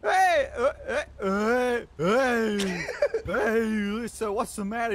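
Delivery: high, hammed-up Staten Island mobster voice with laughs; Staten Island accent. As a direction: push a cartoon New York Italian accent, breaking into laughs. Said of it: "We need more Staten Island".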